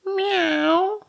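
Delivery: very high, drawn-out meow; annoyed cat. A narrator's reading. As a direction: cry like a hungry, annoyed cat. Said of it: "confident cat voiced his annoyance".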